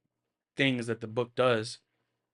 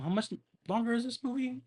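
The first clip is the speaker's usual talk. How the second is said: much higher, exasperated squeak; 12 semitones higher than usual. Real, not acted. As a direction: plead in a pitched-up disbelieving voice. Exasperated aside at long movie.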